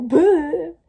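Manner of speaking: sudden loud high Boo; jump-scare yell; startling boo. Retold or imitated. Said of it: "She'll come up behind me and go".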